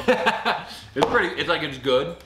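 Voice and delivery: high laughing answer. Real, not acted. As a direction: laugh through the verdict. Laughing after tasting.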